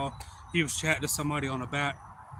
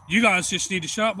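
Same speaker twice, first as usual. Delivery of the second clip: loud, higher mock scolding; retold quarrel, voice raised; imitated angry stranger; 5 semitones higher, 7 dB louder than usual. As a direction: tell them off in a retold holler. Retold stranger argues back.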